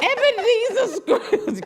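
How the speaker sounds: high, loud, slow spooky ghost voice. Acted. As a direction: boom the name out like a stage ghost. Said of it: "pretty good ghost voice".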